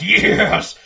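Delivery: loud high cheer with a laugh; excited cheer. Retold or imitated. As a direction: cheer like you finally got it. Game's best moment, cheering.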